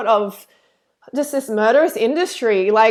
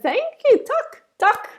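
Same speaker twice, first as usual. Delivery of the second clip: high, playful attempt at a Swedish accent; mock Swedish thanks; 13 semitones higher than usual. Thanking a Swedish donor, high mock Swedish accent. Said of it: "that Swedish two hundred".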